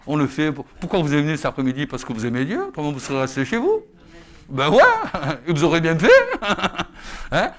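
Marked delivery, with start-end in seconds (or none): none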